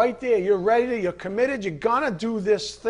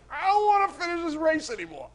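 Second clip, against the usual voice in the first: mock whining; 11 semitones higher than usual. Weary runner giving up.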